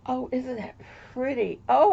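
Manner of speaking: ordinary talk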